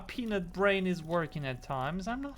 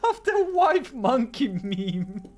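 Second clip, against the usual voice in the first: high, loud laughing squeal, drawn out; through laughter; 12 semitones higher, 8 dB louder, much slower than usual. Real, not acted. Friend reminded of a silly meme, laughing helplessly. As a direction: squeal it slowly while cracking up.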